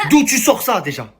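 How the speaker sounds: high, incredulous challenge; real indignation. Real, not acted. Man challenging whoever invented his words, indignant and high-pitched. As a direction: demand an answer in angry disbelief. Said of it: "pourquoi je suis énervé".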